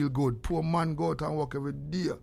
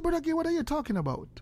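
high, quick imitation of a puzzled boy; little boy; 13 semitones higher, faster than usual. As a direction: lightly mimic a child questioning an elder. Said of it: "Me see little boy".

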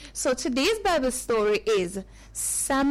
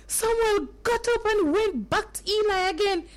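usual speaking voice

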